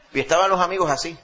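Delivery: normal talking voice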